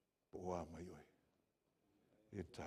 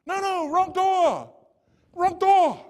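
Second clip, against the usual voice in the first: very high, loud, slow falsetto insisting; shrill old lady refusing help, hammed up; insistent old lady; 21 dB louder, much slower than usual. Preacher voicing the old lady insisting at his door, very high and loud. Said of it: "sheepishly says".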